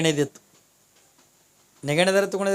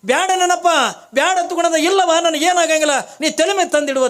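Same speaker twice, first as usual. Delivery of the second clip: urging holy man; 8 semitones higher, 4 dB louder than usual. Acted.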